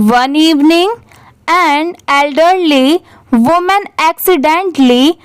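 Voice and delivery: very slow word-by-word model reading with pauses; slow paragraph reading. Shown as a demonstration. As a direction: read it out for learners, pausing between phrases. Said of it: "वहाँ पर थोड़ा सा रुकिए".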